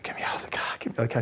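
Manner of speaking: Mason's unvoiced plea, quiet; whispered plea. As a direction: half-whisper the line in character. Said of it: "I turned into Mason suddenly".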